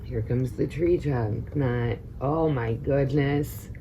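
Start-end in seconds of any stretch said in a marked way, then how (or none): none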